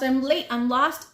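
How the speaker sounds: loud repeat of late and lost; yelling. A narrator's reading. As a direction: keep calling out.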